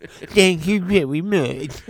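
slow, high, pitiful thank-you; hammed-up poorly caller; co-host parodying the sick caller, drawn out high; mock sick voice. Co-host mocks his sick voice.

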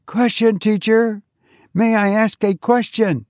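put-on a child keen to ask; eager child. A narrator's reading. Child raises hand.

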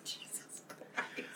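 breathy whispered aside. Real, not acted. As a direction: whisper it in disbelief. Whispered reaction.

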